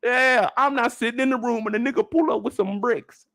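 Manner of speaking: normal talking voice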